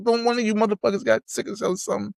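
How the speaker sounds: high, fast rapped line. Retold or imitated. Repeating the diss line to his cohost: high, fast recitation. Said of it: "He don't give a fuck".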